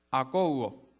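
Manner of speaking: Akouo with the accent on the O; stress on the O. Shown as a demonstration. Preacher sounding out a hard Greek word, stress on the O. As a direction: say Akouo slowly, leaning on the O. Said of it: "con acento en la O".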